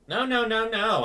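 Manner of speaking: eager protest. Acted. Quick insistent protest to Furina.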